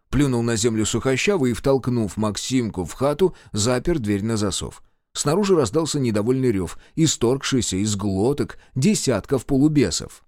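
ordinary delivery

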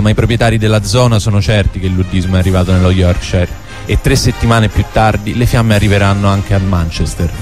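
ordinary talk

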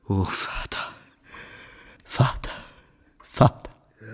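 breathless whispered sigh; mock-grieving whisper. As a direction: feign heartbreak in a whisper. Franz feigns grief to his father. (geflüsterter, gespielter Kummer)

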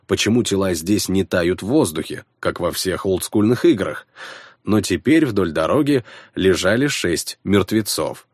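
usual speaking voice

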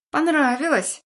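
put-on high smug boy voice; sly bratty kid. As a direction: tease like a sly little brat. Kid mocks brother slyly.

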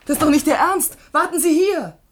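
hoch und laut, entsetzt; empörter Ruf. Acted. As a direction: ihn mit aller Stimme aufhalten. Secretary tries to stop him. (Sekretärin schreit dem Studenten hinterher, hoch und empört)

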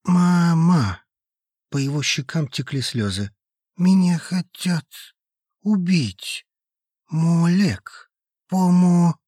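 frightened crying. A narrator's reading. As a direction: cry out like a scared little girl. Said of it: "похож на маленькую, смертельно испуганную девочку".

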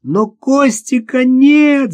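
high, loud, drawn-out wail; tearful cry. A narrator's reading. Sister answers that the boy is gone, crying out tearfully. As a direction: cry it out in despair, stretching the words. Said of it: "закричала со слезами в голосе Наташа".

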